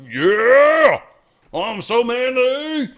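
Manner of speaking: hammed-up muscleman boasting; mock Schwarzenegger flexing, shouted; mock macho bro. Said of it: "you do that exercise and like".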